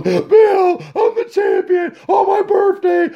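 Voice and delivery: high, slow blubbering brag; mock crying champion. Mocking Fury crying about his title to Bill, high slow blubber. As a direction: wail it through fake tears. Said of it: "he is throwing down the tears".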